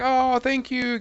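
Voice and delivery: grateful. Retold or imitated. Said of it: "I'm like".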